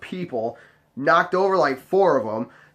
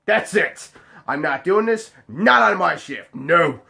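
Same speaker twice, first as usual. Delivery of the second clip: raised, emphatic fed-up declaration; higher-pitched, punchy refusal; fed-up outburst; 3 semitones higher, 3 dB louder, slower than usual. Retold or imitated. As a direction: act out putting your foot down. Acting out his fed-up outburst.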